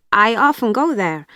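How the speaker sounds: usual speaking voice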